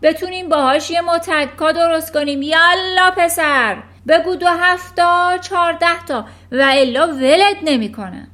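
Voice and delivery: very loud, high threatening shout; furious shout. Acted. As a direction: threaten him into saying it. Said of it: "فریاد زد". (فریاد تهدیدآمیز و بلند)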